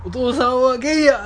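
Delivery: 高く大声で言い放つ; 泣きながら叫ぶように打ち明ける; 叫ぶ告白. Retold or imitated. 泣く父の告白を再現. (父が泣きながら叫ぶ告白)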